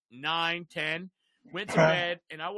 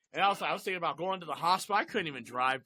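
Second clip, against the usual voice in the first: ordinary delivery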